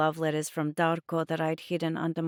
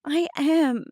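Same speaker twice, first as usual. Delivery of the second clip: high, loud, upset protest; insist, close to crying; near tears; 10 semitones higher, 6 dB louder than usual. A narrator's reading. Girl protesting to Mum, on the verge of tears. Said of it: "I was on the verge of tears".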